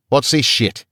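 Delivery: Australian guard snaps "What's this shit?" loud and high, falling on "this"; Australian guard demanding an answer, loud; angry demand. A narrator's reading. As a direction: demand it with menace. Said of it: "he demanded".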